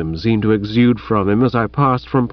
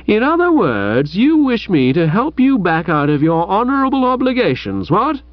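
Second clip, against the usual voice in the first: indignant; 7 semitones higher than usual. Acted. Chuffy indignant at Bertie.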